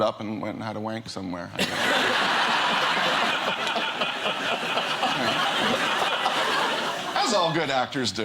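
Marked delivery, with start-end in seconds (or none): 1.7-7.1 long breathy laugh; long laughter. Real, not acted. Guest laughing at own punchline, long laugh. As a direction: let the laugh run out.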